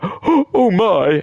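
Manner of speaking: hammed-up horrified exclamation; mock shock. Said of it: "they'll just be like".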